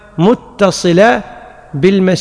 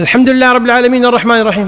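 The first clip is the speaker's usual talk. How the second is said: rapid recitation, a little higher; fast imam recitation; 5 semitones higher, much faster than usual. Shown as a demonstration. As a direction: race through it like a hurried imam. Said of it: "قال". (تلاوة إمام مستعجل)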